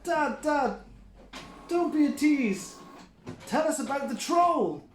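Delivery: put-on high whiny child voice; pleading kids. A narrator's reading. Goat boys plead with dad, high and wheedling. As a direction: beg for the troll story. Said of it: "hopped about and said".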